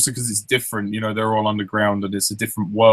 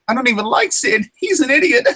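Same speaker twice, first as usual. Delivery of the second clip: very high, loud squeaky voice; cartoon rant; 13 semitones higher, 4 dB louder than usual. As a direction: squeal the complaint. Quoting characters with friend.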